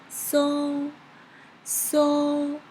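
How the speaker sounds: "sol" sung high and held level; high flat tone. Shown as a demonstration. Teacher modelling the first tone by singing, high flat "sol". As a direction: hold "sol," on one high, even note. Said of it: "It is very high and flat".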